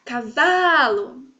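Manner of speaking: "cavalo" called slowly with a long stressed VA; drawn-out "cavalo", swelling on the middle syllable; calling out. Shown as a demonstration. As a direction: call "cavalo", stretching "va". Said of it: "Se eu vou chamar".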